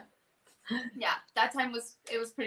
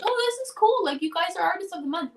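excited announcement; 9 semitones higher, 5 dB louder than usual. Retold or imitated. Someone excitedly breaking the news.

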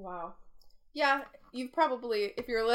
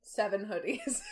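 usual speaking voice

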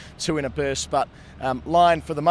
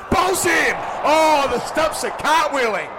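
excited wicket call; 13 semitones higher, 3 dB louder, slower than usual. Acted. Bowled, commentator erupts.

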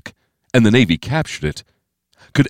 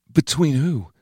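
higher, hushed-off question; Steve's voice; 7 semitones higher than usual. A narrator's reading. Steve asking who fought, higher uneasy voice. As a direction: lift the pitch into a younger character's question.

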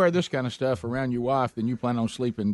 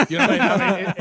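laughing; 7 semitones higher, 7 dB louder, faster than usual. Real, not acted. Laughing, can barely talk.

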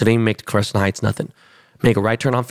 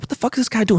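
retold outburst, much higher pitch; mock incredulous, pitched way up; exasperated disbelief; 12 semitones higher, faster than usual. Retold road confusion, own reaction.

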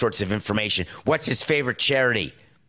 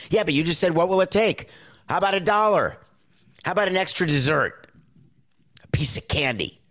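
mock kid; 8 semitones higher than usual. Kid bargaining with parent.

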